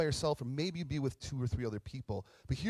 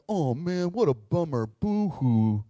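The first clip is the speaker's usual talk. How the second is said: loud, hammed-up fake sympathy; mock pity; 2 semitones higher, 9 dB louder, slower than usual. Jesus answering lepers with the wrong pity, mock sympathy. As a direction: drag out a sarcastic boo-hoo. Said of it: "I think of pity like that".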